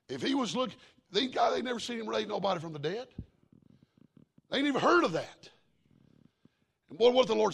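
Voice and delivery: loud, high revival preaching; preaching at full force. Acted. Preacher rousing his church, loud and high. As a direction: lift the congregation, voice ringing.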